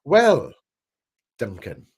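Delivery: usual speaking voice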